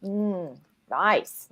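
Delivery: ordinary talk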